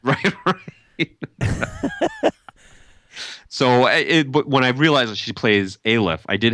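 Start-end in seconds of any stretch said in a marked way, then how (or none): 0.0-1.1 laughing through the words; words breaking into laughter. Real, not acted. 1.1-2.3 squeaky burst of laughter; high laugh. Real, not acted. Laughing at breakdance teasing.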